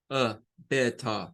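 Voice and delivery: British "better" ending in schwa, no R; London "better", T clear, final R dropped; British accent. Shown as a demonstration. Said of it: "al final el schwa". (acento británico, better terminando en schwa sin R)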